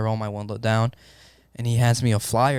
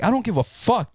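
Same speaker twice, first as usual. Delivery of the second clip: much higher, frantic retold outburst; 10 semitones higher than usual. Paramedic snapping at his partner who won't drive, frantic high outburst. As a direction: pitch it up in panic.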